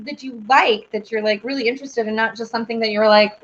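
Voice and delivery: normal talking voice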